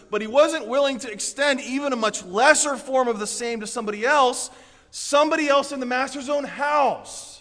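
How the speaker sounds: loud, high, driving sermon voice; forceful preaching. Acted. Pastor preaching to the congregation, loud and driving. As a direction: preach it hard to the whole room.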